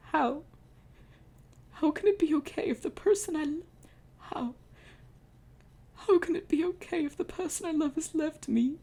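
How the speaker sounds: very high, pleading voice; high anguished lament; despairing grief. Acted. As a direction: cry out at the loss. Sobbing pharaoh despairs.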